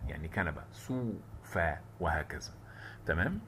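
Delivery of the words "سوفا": sofa drawn out slowly, syllable by syllable. Shown as a demonstration. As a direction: stretch سوفا long and slow. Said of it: "هقول سو".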